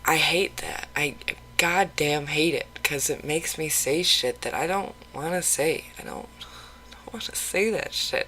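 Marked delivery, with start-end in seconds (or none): 6.0-8.3 whispered, softer, under her breath; real whisper. Real, not acted. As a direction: hush it as if ashamed to say it. Confessing what she hates saying.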